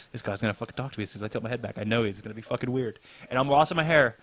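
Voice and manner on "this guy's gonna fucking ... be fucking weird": low, softer, very fast muttering; hushed racing inner dread; panicked inner voice. Retold or imitated. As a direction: rattle it off under your breath. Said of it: "I was like".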